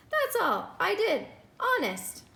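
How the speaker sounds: put-on high, pleading girl's voice; pleading innocence. Lulu insisting to the nurse, high and pleading.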